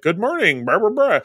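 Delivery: breezy nurse-like greeting, barely acted; imitating Moira. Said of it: "she's just like".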